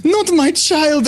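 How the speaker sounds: mournful keening wail. Acted. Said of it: "a shriek from the other room".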